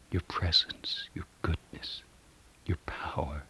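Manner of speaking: trailing down into a whisper; whispered fading prayer. Real, not acted. Prayer list fades to whisper.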